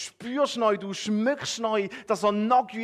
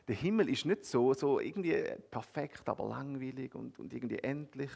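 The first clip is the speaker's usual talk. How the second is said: softer, lower, calm picture-painting voice; gentle hushed sermon aside; hushed guided imagery; 5 semitones lower, 4 dB quieter than usual. Acted. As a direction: draw them in quietly, like a meditation. Eyes-closed imagery of still pond.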